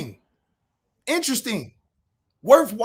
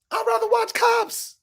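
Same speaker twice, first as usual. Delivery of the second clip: mocking repeat; 14 semitones higher than usual. Shown as a demonstration. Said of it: "repeats the jab, drawn out".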